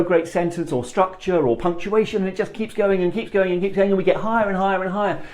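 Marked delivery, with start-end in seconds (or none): 2.2-5.2 rushing, pitch climbing higher and higher; hurried, rising nervous gabble; mock gabbling. Shown as a demonstration. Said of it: "We tend to get speed up".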